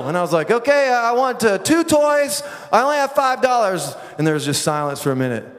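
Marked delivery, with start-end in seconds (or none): 0.5-3.9 high, raised holler over distance; screamed bartering. Retold or imitated. Hiker bartering with traders across the river, high yelling. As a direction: call each phrase out across a river. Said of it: "I screamed into Mexico".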